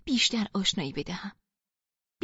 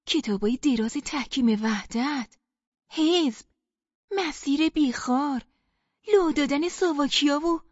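eager reminding; 4 semitones higher, 3 dB louder than usual. Acted. Listing shared past, pleading.

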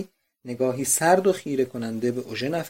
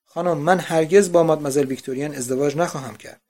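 louder vow of horrified refusal; horror and disgust; 7 dB louder than usual. A narrator's reading. Eugène tells Madame Vauquer he will never marry Victorine, louder. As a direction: declare it firmly, recoiling. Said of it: "با احساس وحشت و نفرتی".

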